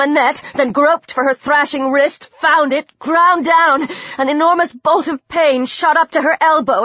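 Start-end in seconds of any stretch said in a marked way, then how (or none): none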